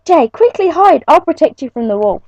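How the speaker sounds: a hint of heroic prince, high urgent start; rally to protect her; bravely. Prince Aramis shields Jay from the wolf, lightly brave call. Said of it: "he said bravely".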